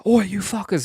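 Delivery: high, loud mock yell of a furious driver; angry driver. Driver hit by a pumpkin yells at the kids, high loud mock fury. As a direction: bellow it like the driver jumping out. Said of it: "someone gets out their car and goes".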